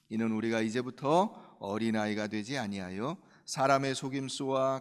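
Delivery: low, measured Bible reading; scripture reading. Acted. Preacher reads Ephesians to the congregation, measured reading. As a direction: read the passage steadily and low.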